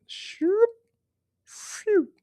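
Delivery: two very high imitated cutting swipes; high slicing whoosh. Shown as a demonstration. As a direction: make a quick high slicing noise. Imitating the sound of rock being cut.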